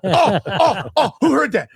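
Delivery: high, loud string of yelps, imitated; mock excited outburst. Son reacting to dad's public blurt, mock excited outburst. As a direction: imitate a worked-up kid hooting.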